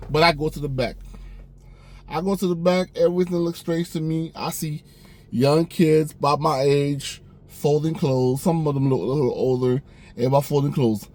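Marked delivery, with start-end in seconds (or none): none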